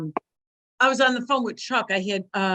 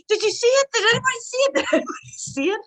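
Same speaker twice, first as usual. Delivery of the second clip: high, urgent retold plea; acted-out panicky high voice; frantic appeal; 13 semitones higher, faster than usual. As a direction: beg bystanders for a witness. Acts out frantic appeal to witnesses.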